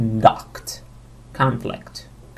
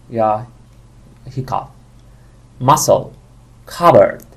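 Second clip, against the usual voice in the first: ordinary delivery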